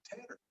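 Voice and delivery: TATTER with a flapped middle T, softly; alveolar flap. Shown as a demonstration. Teacher asking students to listen, soft "tatter" with flapped T. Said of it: "it's a little different".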